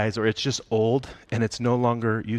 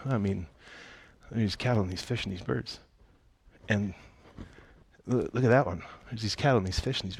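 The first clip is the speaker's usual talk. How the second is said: softly musing, imitated aside; understated showing off; 5 dB quieter, faster than usual. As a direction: quietly point things out, as if thinking aloud. God casually showing off creation.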